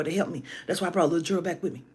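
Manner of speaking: usual speaking voice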